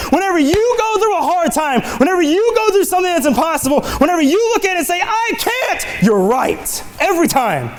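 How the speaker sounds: high, excited preaching; worked-up preacher tripping over words; too excited. Real, not acted. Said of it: "I'm getting too excited".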